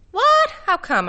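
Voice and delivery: sudden high startled what; cried out. A narrator's reading. Arnold reacts to being told to leave, high startled cry. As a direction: yelp it, caught off guard. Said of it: "Arnold Friend cried".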